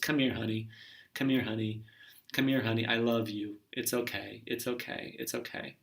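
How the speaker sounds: soft, tender, fading to a whisper; soothing parent. Retold or imitated. Parent comforting hurt child, tender and fading soft. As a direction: comfort a crying child gently. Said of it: "what that child wants".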